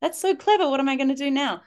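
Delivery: very high marvelling voice; mock amazement. Mum praising the child who beat her rule, high mock wonder. Mum retelling being outwitted.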